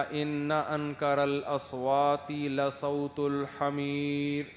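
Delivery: slow chanted recitation of the verse; Quranic recitation. Acted. Preacher reciting a Quran verse to the congregation, slow chant. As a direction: recite it slowly, like tajweed.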